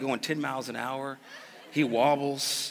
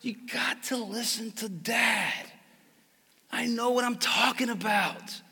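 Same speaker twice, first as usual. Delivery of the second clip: higher, urgent, rising scolding; pleading frustrated dad; 9 semitones higher, 4 dB louder than usual. Retold or imitated. Father scolding his bleeding son, frustrated but tender. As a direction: plead with a hurt child. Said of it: "I was like".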